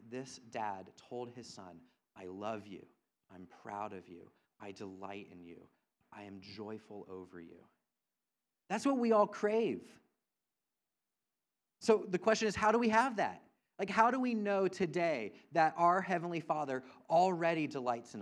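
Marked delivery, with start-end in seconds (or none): 2.1-7.6 soft, hushed words of a loving father; quiet, tender fatherly reassurance; tender dad. Retold or imitated. Dad telling his son he loves him. 8.7-16.0 high and loud, pressing the question home; rising sermon fervour; preaching at full force. Acted. Preacher building to the big question.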